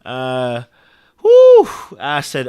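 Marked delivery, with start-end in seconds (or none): 1.2-1.7 high, loud whoop of overwhelm; emotional whew. Real, not acted. Viewer overcome by the film scene, high loud whew.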